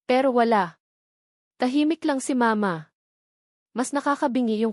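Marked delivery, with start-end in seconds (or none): none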